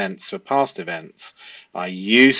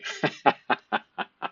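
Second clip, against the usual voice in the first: a soft breathy laugh at the liar; real amused chuckle; 3 semitones higher, 4 dB quieter than usual. Real, not acted. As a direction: chuckle quietly to yourself. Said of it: "it makes you giggle".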